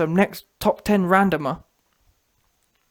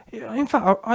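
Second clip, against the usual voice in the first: ordinary talk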